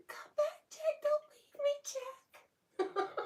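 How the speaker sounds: soaring high desperate falsetto plea; hammed-up Rose crying after Jack; Rose from Titanic. Owner reading a viewer's Titanic joke, hammed-up Rose wail. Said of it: "But she said".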